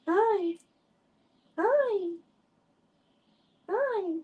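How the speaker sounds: very high cooed hi; pet greeting. Real, not acted. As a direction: greet the cat sweetly high. Greeting the cat.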